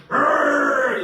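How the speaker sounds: loud, high mock snarl; angry dog growl. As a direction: growl like a furious little dog. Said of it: "He was so angry".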